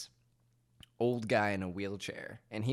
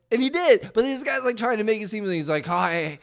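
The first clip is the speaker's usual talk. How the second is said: louder, higher exasperated storytelling; worked-up retelling; 7 semitones higher, 10 dB louder than usual. Real, not acted. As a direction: rant about the faker. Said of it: "retelling, rising indignation".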